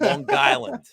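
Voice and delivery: Long Island pitched high with a nasal aw; a hammed-up Long Island Long Island; Long Island accent. Shown as a demonstration. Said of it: "it's pronounced".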